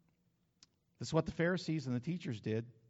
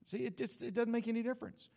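ordinary talk, nothing marked

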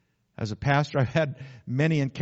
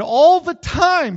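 a slow, much higher, much louder wail; anguished cry; 13 dB louder, much slower than usual. Retold or imitated. Preacher voicing the girl in the tank, a slow loud cry. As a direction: let it burst out of her. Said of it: "she said".

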